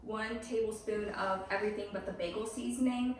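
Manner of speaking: usual speaking voice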